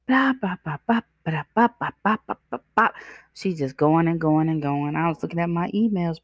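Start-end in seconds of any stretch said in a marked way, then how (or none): 0.1-2.9 higher, sing-song yapping; ridiculing the lady's endless complaining in sing-song; mocking blah-blah chatter. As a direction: parody her nonstop talking.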